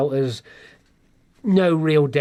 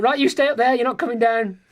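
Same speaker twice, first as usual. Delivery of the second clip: high-pitched mock order from a mum; imitated bossy mum; 7 semitones higher than usual. Mum orders positive son to stay upstairs, imitated bossy mum. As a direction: hand down a comic motherly command, pitched up. Mum's order voiced in story.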